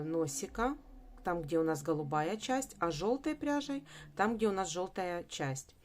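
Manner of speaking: ordinary delivery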